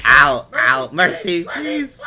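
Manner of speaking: mock pained plea.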